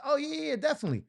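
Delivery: high eager yeah yeahs; side girl voice. Retold or imitated. Side girl brushing off the breakup, high and breezy. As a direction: toss it off high, breezy agreement. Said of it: "And she was like".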